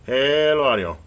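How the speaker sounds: loud, drawn-out radio test call; calling out to check the transmit audio; mic check call. Real, not acted.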